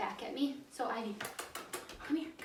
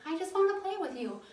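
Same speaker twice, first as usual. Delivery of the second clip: lightly voiced eager puppy, higher; playful puppy voice; 6 semitones higher than usual. Trainer voicing the puppy's wish to play, light and high. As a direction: give the puppy's side, a bit higher.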